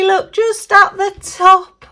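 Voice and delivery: usual speaking voice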